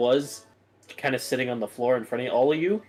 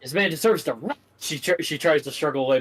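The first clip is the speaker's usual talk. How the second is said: usual speaking voice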